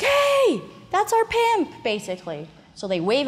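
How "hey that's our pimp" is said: much higher, loud "hey" calling out; excited hooker. Retold or imitated. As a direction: hail the pimp like an excited girl. Hookers spotting their pimp.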